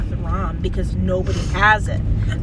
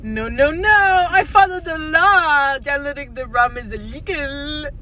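imitating French guy; 5 semitones higher, 6 dB louder than usual. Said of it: "some dude in France who was like".

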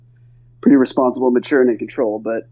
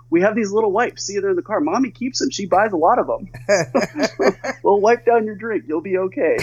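reassuring, higher; 7 semitones higher than usual. Retold or imitated. Mom reassuring scared son, quoted.

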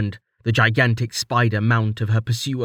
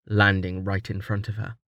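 ordinary delivery

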